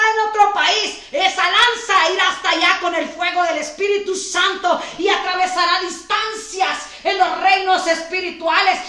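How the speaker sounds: shouting with bursts of laughter; prophetic shout. Real, not acted. Pastor proclaiming over families far away, shouting joyfully. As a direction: cry it out over the crowd, joyful.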